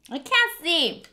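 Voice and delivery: ordinary delivery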